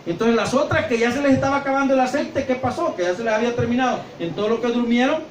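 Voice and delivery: ordinary talk, nothing marked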